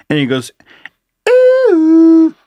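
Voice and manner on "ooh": high-pitched machine chime and voice; talking robot vacuum. Retold or imitated. Host imitating the Roomba waking them at night, high robot voice. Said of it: "it was a real voice coming out of the thing".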